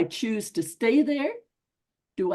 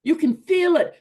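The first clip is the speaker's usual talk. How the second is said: real rising frustration, louder and higher; getting angry; 6 semitones higher, 7 dB louder than usual. Real, not acted. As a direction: let the anger build as you talk. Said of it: "I'm getting angry".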